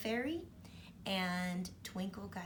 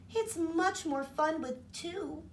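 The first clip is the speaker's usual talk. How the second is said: happy fairy; 8 semitones higher, 3 dB louder, slower than usual. Acted. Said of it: "laughed Twinkle happily".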